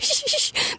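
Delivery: soft, high hushing; quiet her down fast; sharp soft shushing. Acted. Hushing friend as mom calls.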